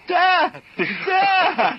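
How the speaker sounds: put-on high, loud, panicked child's cry; sobbing kids. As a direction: wail it like a terrified kid. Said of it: "the kids look at him just sobbing, going".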